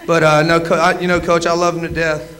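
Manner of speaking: ordinary delivery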